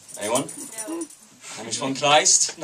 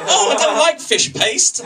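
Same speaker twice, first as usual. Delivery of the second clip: clueless expat; 13 semitones higher, 5 dB louder than usual. Acted. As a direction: whine it high like a clueless expat. Clueless expat misunderstands, mimicked.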